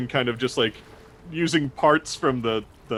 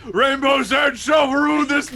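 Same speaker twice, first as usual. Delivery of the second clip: grand proclamation; 13 semitones higher, 5 dB louder than usual. Acted. Grandiose declaration.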